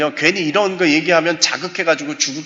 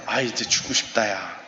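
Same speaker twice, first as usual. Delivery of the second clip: despairing confession; 5 semitones lower, 5 dB quieter than usual. Retold or imitated. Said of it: "심지어".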